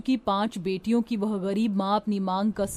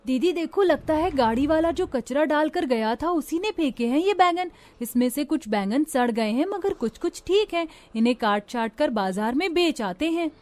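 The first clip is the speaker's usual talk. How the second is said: put-on higher, lively girl's voice; eager little sister, voice raised in pitch; younger sister; 6 semitones higher than usual. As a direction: pitch it up as a girl suggesting a plan. Younger sister talks to elder.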